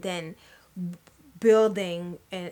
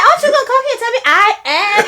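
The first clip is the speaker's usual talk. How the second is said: higher, louder, fast, laughing; high fast giggling question; laughing mock plea; 13 dB louder, much faster than usual. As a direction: beg through laughter. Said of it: "I'm like".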